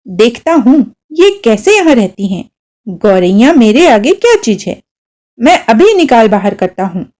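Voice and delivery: ordinary delivery, nothing marked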